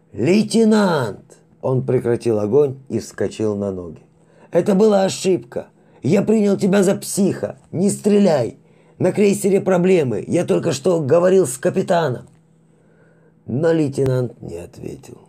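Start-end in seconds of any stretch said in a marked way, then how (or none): 0.1-1.4 loud drawn-out call; shout. Acted. Shouting to the lieutenant. 4.5-12.3 high, loud, frightened pleading; panicked plea. Acted.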